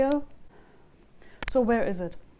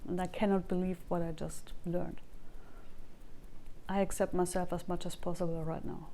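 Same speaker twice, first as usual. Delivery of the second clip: tapping script; 2 semitones lower, 4 dB quieter than usual. Acted. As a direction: say each line for viewers to echo back. Leads a tapping sequence.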